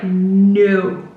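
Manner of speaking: firm no with a laugh. Retold or imitated. Taking her power back, a firm no said with a laugh. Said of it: "I said, like".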